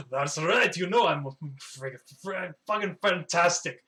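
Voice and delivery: puffed-up, showy bragging; boastful dwarf. Acted. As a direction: flex and brag with swagger.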